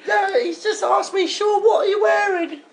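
very high put-on motherly voice; caller's mum. Dave's mum introducing herself on the phone, high put-on voice. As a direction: play a mum in a high, squeaky register. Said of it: "It's Dave's mum".

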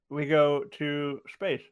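words round a mouthful of ice; mouth full of ice. Real, not acted. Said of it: "I have ice in my mouth".

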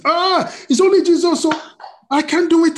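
high, loud protest of false modesty; mock humility. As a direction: exclaim in exaggerated, self-belittling awe. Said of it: "We try to be humble".

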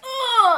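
high, loud imitated "oh"; mock outcry. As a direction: cry out in hammed-up distress. Acting out the man's sudden outcry.

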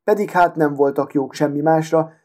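usual speaking voice

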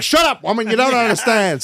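hammed-up old-fashioned husband telling her off; mock fifties husband. Telling his mum off like a fifties husband, mock gruff. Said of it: "like some old fifties husband".

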